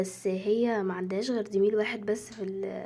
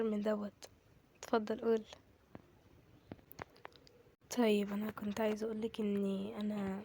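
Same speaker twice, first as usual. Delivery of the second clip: soft, halting near-confession; shy hesitation; 9 dB quieter than usual. Acted. Shy, hesitant confession in doll drama.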